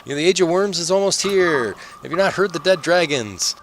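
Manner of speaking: high, ranting prophet voice; doomsayer character. Acted. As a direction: warn them that doom is near. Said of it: "He kinda keeps reiterating his story".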